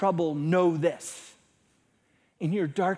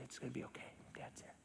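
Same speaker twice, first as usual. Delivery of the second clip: very soft, half-whispered reassurance; soothing dad; 4 semitones lower, 21 dB quieter, much faster than usual. Retold or imitated. Dad comforting feverish daughter at night, hushed soothing. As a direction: hush and reassure a small child.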